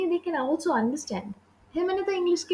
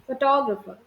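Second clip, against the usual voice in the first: Photographer stressed on its second syllable; fo-TO-gra-fer for photographer, falling after the stress; 3 semitones lower than usual. Shown as a demonstration. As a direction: lean on the TO in photographer. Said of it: "Second part of the syllabus".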